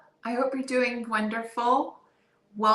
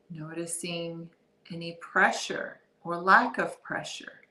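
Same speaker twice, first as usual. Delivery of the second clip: calm, softer body-scan voice with long pauses; guided meditation; 3 dB quieter than usual. Real, not acted. Guide leading listeners through a body scan, guided meditation. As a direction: ease them into awareness, unhurried and soft.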